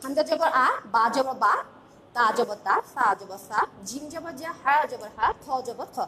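each letter with জবর chanted to its short a sound; syllable drill. Shown as a demonstration. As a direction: recite the line steadily for the pupil. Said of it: "দেখো".

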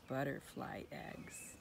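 put-on hushed aside to the children; conspiratorial storytime whisper. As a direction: whisper it like a secret.

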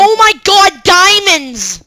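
high, loud thrilled cry; excited shout. Real, not acted. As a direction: shriek with delight at the find. Finding diamonds excitedly.

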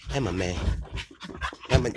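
usual speaking voice

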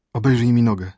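loud, pained outburst; moaned plea. A narrator's reading. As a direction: cry out for help in pain. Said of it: "jęknął Horus". (głośny jęk bólu)

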